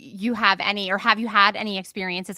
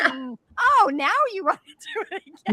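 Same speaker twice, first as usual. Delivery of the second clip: teasing mock; 10 semitones higher than usual. Teasing husband about views.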